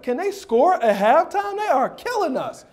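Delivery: high-pitched retold outrage; exasperated, high and incredulous; indignant disbelief. Retold outrage at halftime score.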